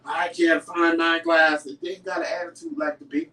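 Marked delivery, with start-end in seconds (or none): 0.1-1.8 slow, loud grumble; loud, slow sulky husband; grumpy husband voice. Retold or imitated. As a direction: mimic him grumbling about his glasses. Imitating husband's grumpy reply.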